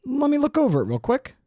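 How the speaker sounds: mock the boss who dreams big and dumps the work; Steve Jobs. Imitating Steve Jobs ordering his engineer, in a high hammed-up voice. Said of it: "Steve Jobs is like".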